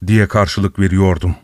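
ordinary delivery

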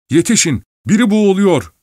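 high, loud alarm call; urgent shout. A narrator's reading. Narrator calling others to a drowning man, urgent shout. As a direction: shout for help, pitch leaping up. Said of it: "diye bağırıyorum".

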